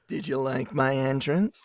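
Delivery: low, soft smug voice; deep hero voice. Acted.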